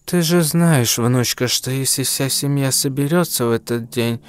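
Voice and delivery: put-on toothless old granny mumble; mumbling old woman. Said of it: "прошамкала старуха".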